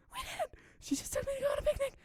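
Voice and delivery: extremely high-pitched squeal; piercing squeaky protest. A narrator's reading. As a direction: squeal the denial out at the top of your range. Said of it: "Sayori squealed".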